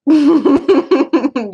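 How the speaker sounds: high, loud run of laughter. Real, not acted. Laughing at stream chat.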